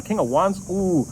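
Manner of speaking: ordinary delivery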